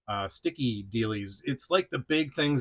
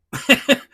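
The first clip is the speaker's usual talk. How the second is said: high burst of laughter; 12 semitones higher, 9 dB louder than usual. Real, not acted. Laughs at toy action feature.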